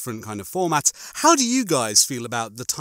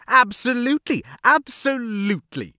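high, loud, over-eager agreement; Rimmer; 12 semitones higher, 4 dB louder than usual. Acted. Rimmer agrees all too readily with Lister, gleeful. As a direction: agree with relish, each word punched up high.

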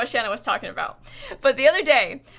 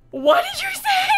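high, loud laughing shout of disbelief; 6 dB louder than usual. Retold or imitated. Siblings in car reacting to sister, shrieking laughter. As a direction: yell it while cracking up. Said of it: "me and Brett are like".

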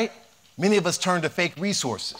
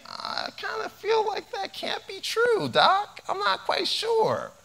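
high, slow sceptical voice; doubting audience member; slower than usual. Retold or imitated. Audience member doubting the doctor, high and slow sceptical. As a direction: play the doubter in the crowd, pitched way up. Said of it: "some of you may be saying".

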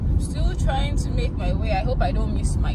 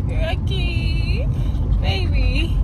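high rising call, a bit louder; 5 semitones higher, 4 dB louder, much slower than usual. Real, not acted. Calling the dog who jumped out, rising call. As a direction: plead for the dog to come back.

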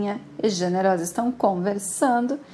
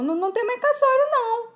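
high fast lament; 12 semitones higher, faster than usual. Acted. Mirna lamenta o casamento desfeito.

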